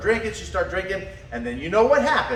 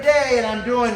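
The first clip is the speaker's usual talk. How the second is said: usual speaking voice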